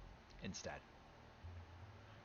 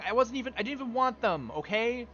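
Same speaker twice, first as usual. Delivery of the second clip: exasperated insisting; 8 semitones higher, 4 dB louder than usual. Real, not acted. Insisting to chat about pulls.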